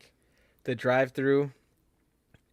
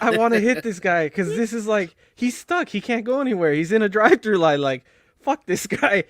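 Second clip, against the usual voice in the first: loud, high-pitched re-enacted road rage; indignant retold tirade; worked-up rant; 7 semitones higher, 6 dB louder than usual. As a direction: relive the outburst, pushing pitch and volume up. Retelling his road rage outburst.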